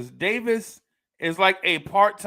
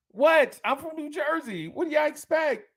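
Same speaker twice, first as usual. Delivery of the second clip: excited higher pitched Jersey defense; defensive outburst; 7 semitones higher than usual. Real, not acted. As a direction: throw up your hands: what do you want. Defending his Jersey habits.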